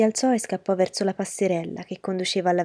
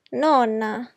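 put-on higher childish whimper; whining; 5 semitones higher, 3 dB louder than usual. A narrator's reading. Punched boy appealing to grandmother, whining. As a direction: whine for grandma's sympathy. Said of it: "piagnuccolò lui".

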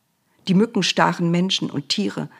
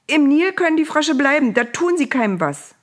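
high, raised anxious voice; calling out; 9 semitones higher, 5 dB louder than usual. A narrator's reading. Pharaoh adds a condition as Moses leaves, calling out. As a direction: call it after them, pitched up. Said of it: "rief er".

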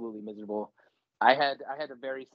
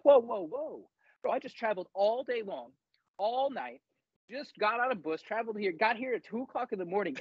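higher, incredulous complaint; exasperated protest; 6 semitones higher than usual. Retold or imitated. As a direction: plead like you can't believe it. Protesting sleepless in-processing.

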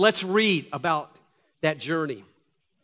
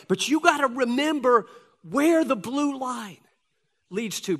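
higher, louder impassioned preaching; preaching at full force; 8 semitones higher, 5 dB louder than usual. Acted. Pastor urging believers facing trials, impassioned preaching. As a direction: lift them toward their eternal hope.

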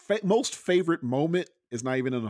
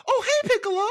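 very high, bright greeting; cheerful little girl; 6 dB louder, faster than usual. Retold or imitated. Pan greeting Piccolo, very high happy girl voice. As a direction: greet him like a delighted kid. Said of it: "Piccolo walks up".

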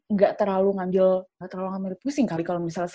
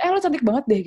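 higher-pitched, abrupt mock catcall; blunt catcall; 6 semitones higher, 3 dB louder, much faster than usual. Shown as a demonstration. Friend blurts out a compliment at her, blunt imitated catcall. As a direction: imitate a too-direct, pushy compliment. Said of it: "jangan langsung kayak".

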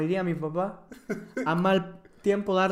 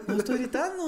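riendo; 5 semitones higher, 5 dB quieter than usual. Shown as a demonstration.

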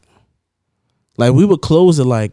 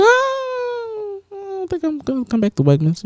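mock whine; slower than usual. Mock reluctant answer, crying sound.

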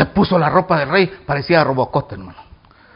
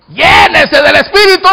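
high, emphatic preacher's shout; shouted exhortation; 9 semitones higher, 6 dB louder than usual. Real, not acted. Preacher answering someone who fell again, shouted exhortation. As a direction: proclaim it as the cure.

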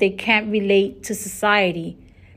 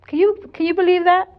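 amazed, pitched-up disbelief; astonished; 10 semitones higher than usual. Real, not acted. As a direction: exclaim it to the listeners in shock. Exclaims at listeners.

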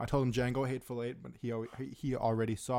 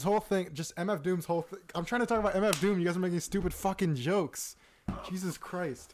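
annoyed; 7 semitones higher, 3 dB louder than usual. Real, not acted. Annoyed at friends' jokes.

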